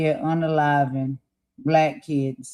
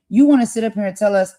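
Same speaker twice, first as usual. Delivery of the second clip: ordinary talk, nothing marked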